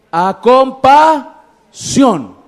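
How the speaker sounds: slow and loud on "compasión"; preacher punches the key word; emphatic answer. Acted. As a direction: draw out "A compasión" and land it hard. Preacher drives home the answer.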